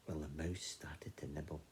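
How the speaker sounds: ordinary delivery, nothing marked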